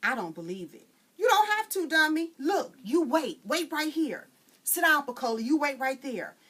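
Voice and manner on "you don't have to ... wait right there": bossy authority. Acted. Frida bosses Pecola, loud and full of authority. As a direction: order them around with zest. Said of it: "Frida was all authority and zest".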